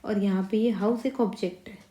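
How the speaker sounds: ordinary talk, nothing marked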